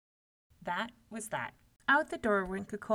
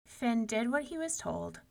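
ordinary delivery, nothing marked

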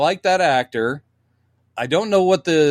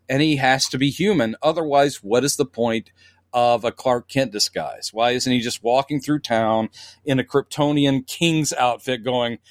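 usual speaking voice

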